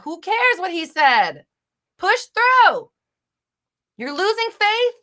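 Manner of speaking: high, loud exasperated yells; full-volume big-sister outburst; frustrated shouting. Real, not acted. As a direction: scream the questions at her. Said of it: "big sister wants to yell at you".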